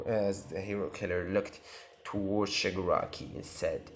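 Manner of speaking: ordinary delivery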